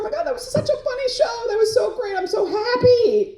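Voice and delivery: high, gushing happy self-talk; high giddy gush, slowing on so happy; giddy joy. Retold or imitated. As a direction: squeal it like you just had the best day. Self-quote, giddy after the show.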